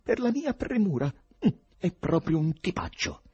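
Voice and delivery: high-pitched, bitter grumbling; bitter thought. A narrator's reading. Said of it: "pensò con amarezza". (amareggiato, tono acuto)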